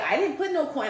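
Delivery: high, loud retold protest; indignant denial. Sister's significant other denies coin, indignant. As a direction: protest innocence, pitch jumping up. Relative denying it, acted.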